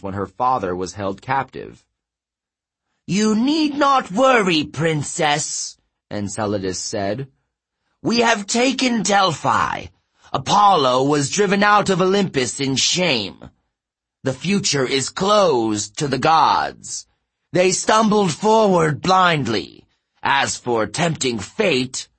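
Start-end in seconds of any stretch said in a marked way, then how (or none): 3.1-5.8 very high, loud reassurance to a princess; giant counselor. Acted. 8.0-22.1 high, emphatic boast of victory; giant counselor. Acted.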